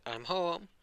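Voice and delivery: high sing-out homecoming call; calling out arrival. Acted. Character announces arrival at home.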